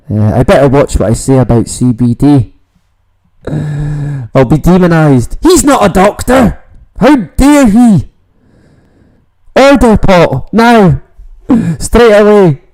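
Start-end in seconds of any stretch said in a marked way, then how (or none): 5.4-12.6 high, hammed-up outrage; mock outraged critic. Mocking imagined critics of his CBD talk, hammed-up high outrage. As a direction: parody the pearl-clutching critics.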